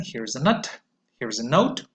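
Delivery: usual speaking voice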